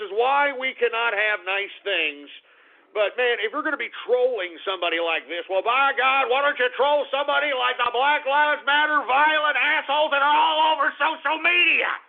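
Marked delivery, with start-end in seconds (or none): 5.5-11.9 high, loud, indignant tirade; indignant yelling at the trolls; exasperated rant. Real, not acted. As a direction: keep climbing in outrage to the end.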